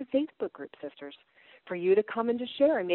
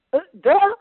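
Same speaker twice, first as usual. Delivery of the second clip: mock duh; 7 semitones higher, 10 dB louder than usual. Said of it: "I'm like".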